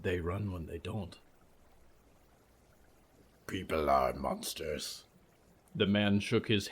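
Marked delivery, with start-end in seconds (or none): 0.0-1.2 soft hushed rueful reply; whispered. Acted. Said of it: "Nolan whispered". 3.5-5.1 low, rumbling monster voice; low creature voice. Acted. Creature speaks.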